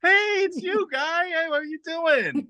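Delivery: high-pitched, gushing buddy greeting; mock friendly. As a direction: imitate a fighter suddenly turned pussycat. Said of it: "like a fucking k-, pussycat".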